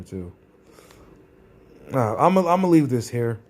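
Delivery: ordinary talk, nothing marked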